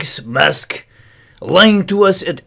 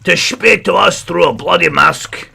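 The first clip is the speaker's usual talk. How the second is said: ordinary talk, nothing marked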